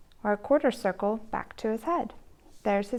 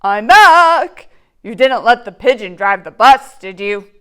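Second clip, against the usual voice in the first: louder cheerful bus driver; the driver comes back to thank the reader; 15 dB louder than usual. Acted. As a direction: return as the friendly driver. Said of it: "bus driver returns".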